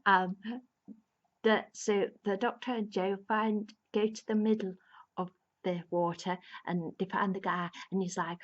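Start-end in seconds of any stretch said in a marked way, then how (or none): none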